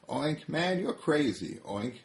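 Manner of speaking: a little pig telling the bull he's nuts; lightly voiced high pig; Piggy. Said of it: "says Piggy".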